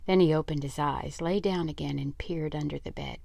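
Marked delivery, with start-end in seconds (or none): none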